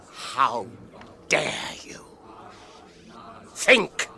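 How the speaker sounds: stern cry of outrage; outraged rebuke. Acted. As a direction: rebuke him sharply. Frodo outraged.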